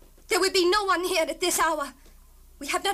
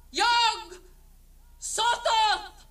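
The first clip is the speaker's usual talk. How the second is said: incantation; 4 semitones higher, much slower than usual. Acted. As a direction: intone the spell. Incantation opens the doors.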